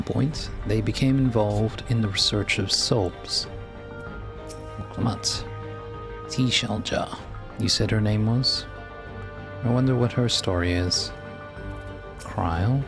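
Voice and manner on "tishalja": normal talking voice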